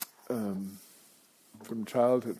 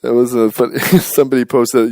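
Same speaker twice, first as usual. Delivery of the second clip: laughing; 10 dB louder than usual. Real, not acted. Laughing, telling the audience.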